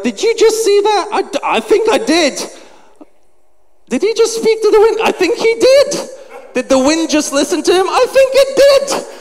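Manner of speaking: very high-pitched acted amazement; gobsmacked. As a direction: squeal it like stunned disciples. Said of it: "They're absolutely gobsmacked".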